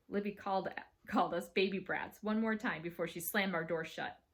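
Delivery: plain reading voice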